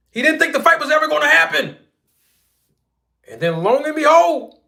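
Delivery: animated storytelling; excited buildup. Acted. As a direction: build the reveal with energy.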